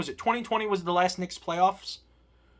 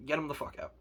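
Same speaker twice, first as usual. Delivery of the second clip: much softer, under the breath; said again quietly to yourself; muttered repeat; 4 semitones lower, 11 dB quieter, faster than usual. Real, not acted. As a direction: drop it low, like a bitter aside.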